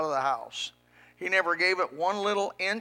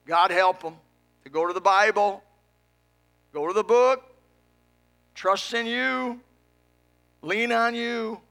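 pleading prayer; 4 semitones higher, 6 dB louder than usual. Real, not acted. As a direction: raise the pitch and stretch each plea.

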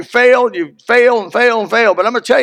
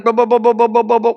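mock machine gun; 4 semitones higher than usual. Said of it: "The, the sound of the machine gun".

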